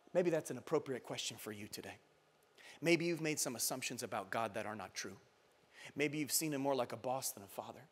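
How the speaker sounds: low, soft, reflective preaching; hushed appeal. Real, not acted. Preacher asking congregation to rethink God, hushed. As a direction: bring the room down quietly.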